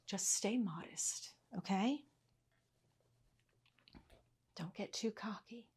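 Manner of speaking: whispered joking warning to the viewers; teasing whisper. Acted. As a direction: let them in on a joke, whispered.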